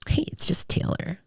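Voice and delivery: soft, breathy confiding whisper; intimate whisper. Retold or imitated. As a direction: whisper it like a secret. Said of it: "She does make you feel like".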